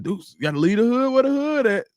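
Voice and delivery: high put-on voice, teasing; mock viewer voice. Quoting a viewer's warning in a high mocking voice. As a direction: squeak it out like a playful scold. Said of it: "one of y'all that was like".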